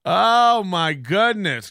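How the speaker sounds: normal talking voice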